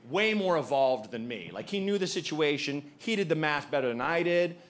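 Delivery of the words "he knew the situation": ordinary talk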